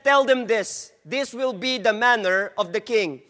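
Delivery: usual speaking voice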